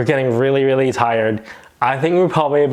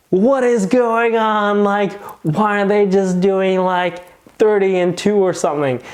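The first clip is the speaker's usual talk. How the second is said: baffled disbelief; 7 semitones higher than usual. Retold or imitated. Bewildered at strangers doing CPR.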